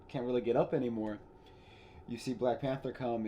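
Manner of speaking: usual speaking voice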